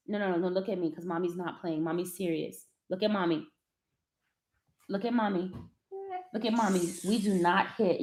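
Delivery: calm but no-nonsense, holding his eyes; quiet firm correction. Real, not acted. Mother quietly scolding her son for hitting, hushed and firm.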